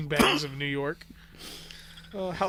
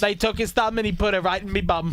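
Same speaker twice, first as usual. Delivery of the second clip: high, loud mock British accent; broad comic British voice; British guy; 5 semitones higher, 4 dB louder than usual. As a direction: do a hammed-up British bloke. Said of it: "something a British guy would say".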